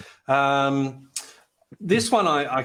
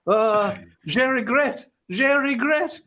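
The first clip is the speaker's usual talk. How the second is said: high, slow, pleading mock-French; nervous French apology; 11 semitones higher, slower than usual. To a French dog, pleading high in mock-French. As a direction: beg the dog for mercy in bad French. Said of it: "standing in the corner going".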